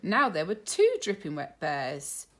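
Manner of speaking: plain reading voice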